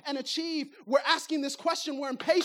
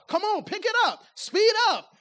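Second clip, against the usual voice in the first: louder, higher push to speed up; impatient urging; 6 semitones higher, 5 dB louder than usual. Retold or imitated. Impatient passenger telling the driver to speed up, loud. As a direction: hurry the driver along.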